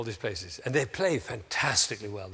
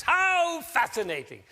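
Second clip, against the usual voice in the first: much higher, slow, arms-up delight; celebrating a mistake; 13 semitones higher, 5 dB louder, slower than usual. Shown as a demonstration. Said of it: "the way to celebrate is like this".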